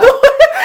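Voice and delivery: sudden loud high laugh; burst of laughter. Real, not acted. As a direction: let a big laugh burst out.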